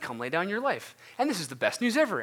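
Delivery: big smile in the voice, lifting each phrase; upbeat upspeak. Acted. Campus leader selling discipleship as the best news, smiling upspeak. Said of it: "go up at the end of all your sentences".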